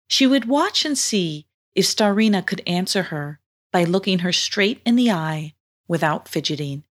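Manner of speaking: plain reading voice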